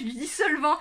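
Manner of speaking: ordinary delivery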